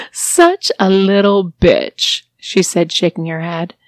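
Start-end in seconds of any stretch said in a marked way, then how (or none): 0.1-2.2 slow, louder, drawn-out scorn; scornful mistress. Acted. Mistress sneers at her new captive, slow and scornful. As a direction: savour every word of the insult.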